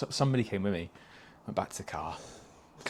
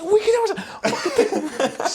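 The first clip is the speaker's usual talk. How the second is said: laughing; 5 dB louder, slower than usual. Retold or imitated. Retold banter, laughing high.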